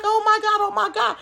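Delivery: high mock-panicked old woman; imitating the neighbor's panic; panicky old lady.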